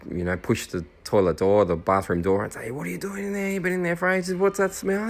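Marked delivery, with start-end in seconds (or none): 2.7-5.1 higher, lightly voiced teasing mates; mocking teammates. Teammates taunting him through the bathroom door, high and teasing.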